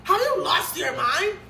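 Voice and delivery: high and loud, half-laughing outrage; flat refusal, shouted through a disbelieving laugh; furious refusal. Retold or imitated. To the pickup driver, refusing to get back in, loudly outraged. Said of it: "I told him".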